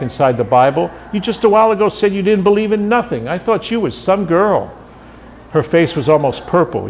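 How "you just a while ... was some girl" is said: high-pitched, incredulous complaint; indignant reproach. A narrator's reading. Salesman accuses the girl of backtracking, indignant. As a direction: reproach her in a much higher pitch.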